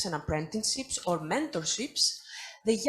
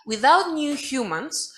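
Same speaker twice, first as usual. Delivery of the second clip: usual speaking voice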